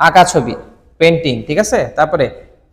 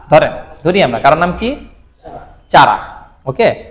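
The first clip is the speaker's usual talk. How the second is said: ordinary delivery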